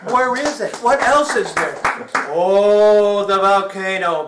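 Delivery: loud, rising search ending in a shouted Pele!; mock discovery. Comic acting out the spirit hunt for his audience, loud and excited. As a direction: hunt about, then shout the find.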